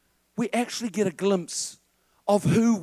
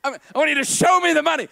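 loud, higher, fast movie catchphrase; excited shout; 7 semitones higher, 4 dB louder, faster than usual. Retold or imitated. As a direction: yell it like a pumped-up football star. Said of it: "and he".

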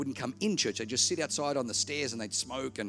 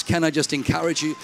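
preaching at full force; 3 semitones higher, 9 dB louder than usual. Real, not acted. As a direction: drive it home to the church. Sermon exhortation to congregation.

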